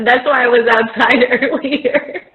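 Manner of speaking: amused confession breaking into laughter; giggly joking admission; real laughing. Real, not acted. Joking about happy hour, laughing.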